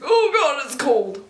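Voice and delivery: high, loud gasp of cold, dropping away; retold shock at cold. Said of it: "I was like".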